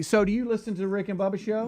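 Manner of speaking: mom's eager, high pitch; eager proud mom. Retold or imitated. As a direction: playfully voice a mom promoting the show. Mom chatting up people at the counter.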